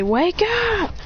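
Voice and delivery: high shout. Acted. Voicing a character waking someone.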